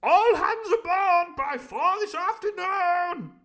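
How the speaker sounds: booming high slow call; shout. Acted. The Squire shouting after Long John, high and slow. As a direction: bellow it after him. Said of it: "shouted the Squire after him".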